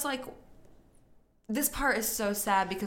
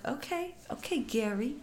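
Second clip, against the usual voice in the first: meek okay; 4 semitones higher, 6 dB quieter than usual. Retold or imitated. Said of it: "And he's like".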